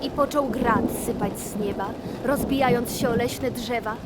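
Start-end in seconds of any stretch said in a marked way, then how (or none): none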